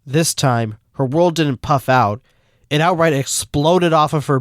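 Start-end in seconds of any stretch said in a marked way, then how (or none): none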